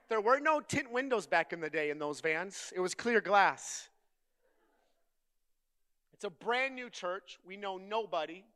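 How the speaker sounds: loud, driving sermon delivery; full-force preaching. Acted. Preacher telling his congregation the story, loud preaching. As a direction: preach it big to the crowd.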